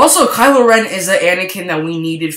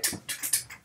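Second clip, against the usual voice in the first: whispered sound effect; 18 dB quieter than usual. Retold or imitated. Stormtroopers reacting to raging.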